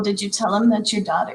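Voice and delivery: ordinary talk, nothing marked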